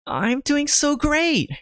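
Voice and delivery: high, drawn-out false enthusiasm; fake cheer. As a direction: overdo the upbeat answer, stretching it out. Faked cheer answering how he is.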